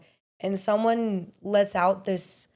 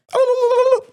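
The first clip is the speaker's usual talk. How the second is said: shrill laugh; 9 dB louder than usual. Retold or imitated. Mocking a gushing apology.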